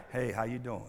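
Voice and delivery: low, soft, smooth come-on; smooth caller. Retold or imitated. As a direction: lightly play the tempting caller. Tempter's call in sermon skit.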